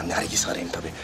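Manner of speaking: unvoiced hushed whisper; whispered aside to his brother-in-law. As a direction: whisper it so the tourists can't hear. Whispers so friend hears only.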